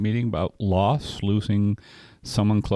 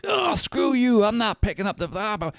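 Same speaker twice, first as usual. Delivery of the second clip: much higher mock shout at his friend; retold defiant outburst; 11 semitones higher than usual. Angry sponsee refusing the friend's advice, high mock shout. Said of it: "And I'm like".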